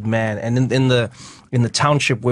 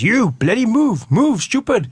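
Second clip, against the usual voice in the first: policeman yelling; 11 semitones higher, slower than usual. Retold or imitated. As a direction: play a rude policeman yelling at the crowd. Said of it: "and he goes".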